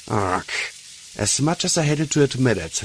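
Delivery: ordinary delivery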